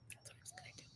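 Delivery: whispered aside. Real, not acted. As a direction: drop to a conspiratorial whisper. Aside after Charlie's warning.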